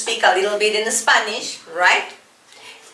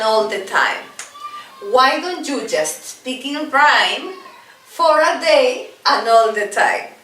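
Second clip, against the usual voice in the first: slow, higher sing-song chant; rhythmic rhyme recital; 4 semitones higher, slower than usual. Shown as a demonstration. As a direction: chant it clearly for learners to copy. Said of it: "Let's do it together".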